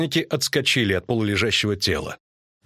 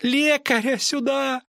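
very high, drawn-out call for help; thin cry; slower than usual. A narrator's reading. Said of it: "тонко крикнул". (тонкий протяжный крик)

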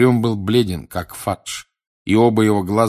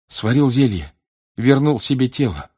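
high, louder, broken phrases; shaken report; 5 semitones higher, 5 dB louder than usual. Acted. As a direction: blurt it out in shock. Shocked boy reports villain's return. (потрясённо, высоким голосом)

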